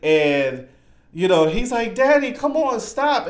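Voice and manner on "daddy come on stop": high mock-pleading girl; high-pitched plea, acted lightly; crying daughter. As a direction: imitate a daughter begging her dad. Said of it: "and while she's crying".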